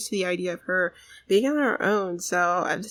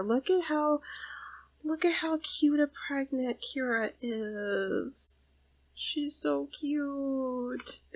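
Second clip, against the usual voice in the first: cooing; 6 semitones higher, 6 dB quieter, much slower than usual. Real, not acted. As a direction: melt over how cute she is. Cooing at pregnant Kira.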